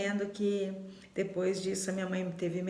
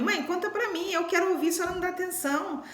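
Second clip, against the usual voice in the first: much higher, wheedling teasing voice; imitating older sister; 7 semitones higher than usual. Older sister teasing the tired daughter by coaxing mom, high wheedling imitation. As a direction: mimic a sister coaxing mom to tell her stories. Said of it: "ela falava pra mim assim".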